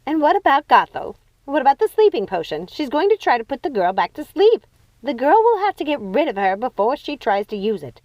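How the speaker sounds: anxious questioning; worried sister. Acted.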